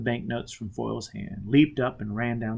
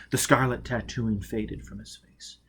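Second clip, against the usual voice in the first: ordinary delivery, nothing marked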